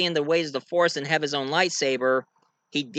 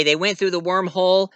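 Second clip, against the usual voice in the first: plain reading voice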